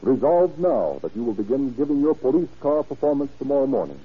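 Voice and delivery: normal talking voice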